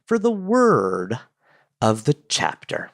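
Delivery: slow, drawn-out announcer build-up for the segment title. Acted. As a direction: announce the segment like a show host. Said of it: "now it is time".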